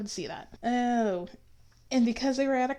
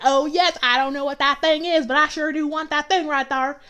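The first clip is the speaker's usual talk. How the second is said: loud, higher, hammed-up Southern twang; exaggerated, eager Southern character voice; mock Southern accent; 5 semitones higher, 8 dB louder than usual. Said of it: "And he's like".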